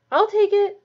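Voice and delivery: loud, high-pitched eager offer. A narrator's reading. Ron offering to carry the broom upstairs, eager. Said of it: "said Ron eagerly".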